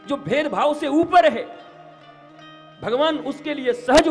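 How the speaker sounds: high, loud, rising sermon; fiery preaching. Acted. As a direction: preach it with full force. Preaching at full force.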